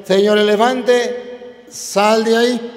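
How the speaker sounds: drawn-out, firmer repeat of the call; a hint of a small frog demanding it again; lightly voiced frog, slow and insistent; frog insisting. Frog repeats order to elephant.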